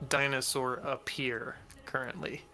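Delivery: ordinary talk, nothing marked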